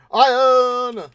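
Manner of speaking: loud, high call of a name. Real, not acted. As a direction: call out sharply to Iron. Calls out to someone nearby.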